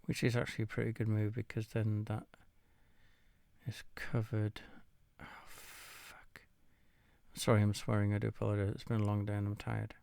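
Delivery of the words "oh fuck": unvoiced whisper, very soft; whispered curse. Real, not acted. Chess player swearing at his own blunder, whispered. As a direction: let it slip out as a whisper. Swearing after a bad move.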